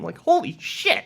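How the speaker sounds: high, astonished exclamation; blurting it out in disbelief; shocked outburst. Real, not acted. Coach reacting to a scary idea, astonished.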